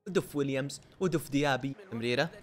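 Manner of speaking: ordinary delivery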